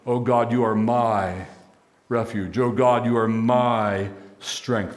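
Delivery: slow, with "my" drawn out and held; stress on my. Shown as a demonstration. Preacher turns "our" into a personal prayer, stress on "my". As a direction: lean long on "my", pausing after it. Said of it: "change the "our" into a "my".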